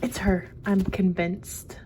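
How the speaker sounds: ordinary talk, nothing marked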